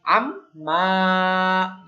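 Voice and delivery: slow aam-maa with the m hummed long; stretched ghunna. Shown as a demonstration. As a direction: draw the m out, nasal and slow. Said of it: "আন".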